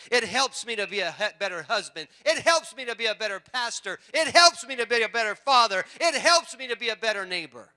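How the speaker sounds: loud, high, hammered repetitions; preaching at full force. Real, not acted. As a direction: build a forceful list from the pulpit.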